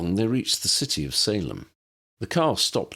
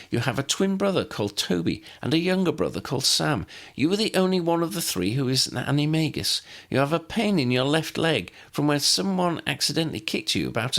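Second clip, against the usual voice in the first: higher, rapid knowing recital; young woman Lydia; 8 semitones higher than usual. A narrator's reading. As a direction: Lydia reels off facts in a trance. Lydia voiced by narrator.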